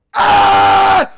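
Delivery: loud, long raw scream; ecstatic scream. Real, not acted. Player winning the special crate, ecstatic scream. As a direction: let out a full-throated victory scream.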